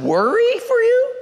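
very high, loud-rising disbelief; incredulous. Acted. New hire questions odd job, squeakily incredulous. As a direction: squeal the question in mock bafflement.

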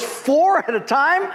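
high, drawn-out disbelieving question; incredulous. Acted. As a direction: echo it back in mock astonishment. Acted shocked pastor in joke.

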